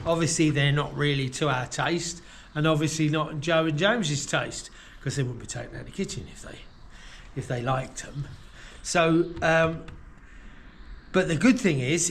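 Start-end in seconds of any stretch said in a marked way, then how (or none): 5.0-6.6 hushed aside, fast; whispering. Real, not acted. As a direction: drop to a quick whisper. Aside about owners' taste.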